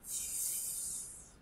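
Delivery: "f" hissed as a long voiceless f sound, no vowel; teacher shows pupils the sound "f", breathed out. Shown as a demonstration. "f" soufflé, sans voix. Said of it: "pour faire le son".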